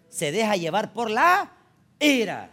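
preacher hammering home the point, slow and loud; rising preaching emphasis. Real, not acted. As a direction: land hard on ira. Preacher stressing loss of control.

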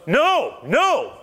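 high loud no; voicing the man pushing Jesus away; mock refusal. Blind man refusing the mud, high and loud.

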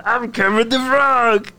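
slow, high, shouted Kermit with a laugh; Kermit the Frog. Acted. Repeating his Kermit for the laughing friends. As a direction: ham up the Kermit again. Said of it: "how does that sound?".

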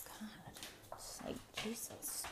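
exasperated whisper. Real, not acted. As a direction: breathe it out under your breath, fed up. Exasperated voiceless aside.

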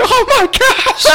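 high, loud, drawn-out wail through laughter; hammed-up shriek of agony, half laughing; retold scream. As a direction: wail it slowly, way up in falsetto. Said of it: "and I screamed".

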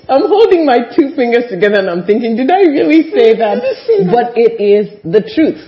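high, loud talk through laughter; laughing. Real, not acted. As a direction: giggle through the words. Laughing about her old answer.

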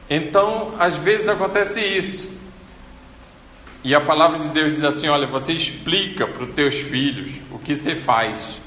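ordinary talk

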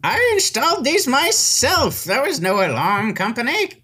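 put-on high indignant old man; old wizard voice. Wizard answers the party's insult, high indignant old man. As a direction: squeak back at the insult.

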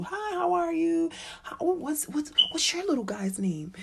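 high, slow, sugary small-talk mom; chirpy mom voice. Retold or imitated. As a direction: ask like a polite stranger at playgroup, pitched high. Voicing the other mother.

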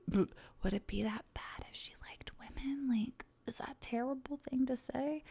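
whispered, hesitant question; nervous seventh grader whispering; timid whisper. Retold or imitated. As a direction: barely voice it like a scared closeted kid. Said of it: "was just like".

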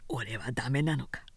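hushed, unvoiced self-doubt; despairing whisper. A narrator's reading. Sōshichi doubting himself, a despairing whisper. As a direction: breathe it out like a broken man.

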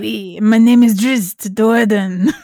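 slow, hammed-up French accent. Host playing a French Drow introducing himself, French accent. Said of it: "Same way with a French accent".

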